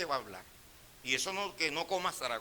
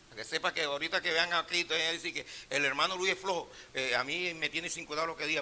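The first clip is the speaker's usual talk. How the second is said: normal talking voice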